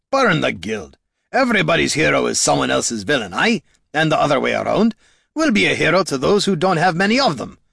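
quick, high, rousing retort; rally them against the establishment; spirited in-character defiance; defiant hero Gorm. Acted.

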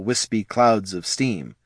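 plain reading voice

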